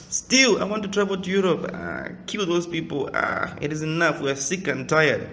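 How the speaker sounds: high, fast nagging wife voice; complaining wife. Retold or imitated. A preacher mimics a wife nagging her husband, high and fast. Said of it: "You will hear a woman telling her husband".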